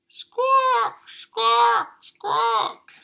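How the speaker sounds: very high, loud squawking bird cries; magpie squawk. Acted. Teacher squawks as the magpie swoops down. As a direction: screech like a magpie.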